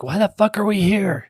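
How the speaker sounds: high, irritated demand; a guest fed up with the dinner party, high indignant question; exasperated outburst. Retold or imitated. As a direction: snap at the whole room in frustration.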